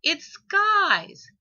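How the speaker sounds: put-on high, loud, slow child's insistence; a stubborn little one digging in; small voice demanding; upset child. A narrator's reading.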